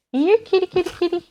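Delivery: high coaxing call to a kitty; calling a cat. Pretend. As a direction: coax the cat in high sing-song.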